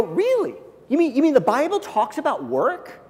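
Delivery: high, surprised "Really?"; curious surprise. Pretend. Coworker amazed the Bible talks about work, high curious surprise. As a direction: sound amazed and intrigued. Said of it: "But if they go".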